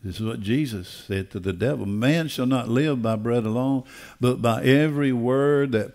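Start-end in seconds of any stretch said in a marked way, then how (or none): none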